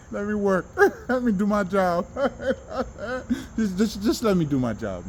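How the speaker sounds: talking through real laughter; laughing, emotional. Real, not acted. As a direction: laugh your way through the plea. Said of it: "I'm being emo".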